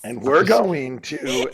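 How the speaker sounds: mock grand announcement, loud and slow; big-reveal speech. Co-host imitating husband's gift speech, grandly. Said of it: "kinda delivered this speech".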